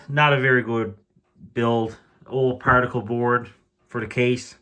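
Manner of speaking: ordinary delivery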